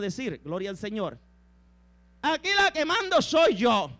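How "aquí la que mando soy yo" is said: raised-pitch declaration of command; bossy wife asserting herself; domineering Jezabel. Acted. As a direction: pitch it up and claim charge. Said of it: "ve a Jezabel".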